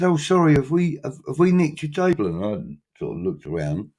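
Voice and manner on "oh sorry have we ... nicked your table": higher, louder apologetic lady's voice; a light imitation of a flustered woman saying sorry; polite woman in the pub. Woman in the pub apologising to the teller for taking his table, lightly imitated higher voice.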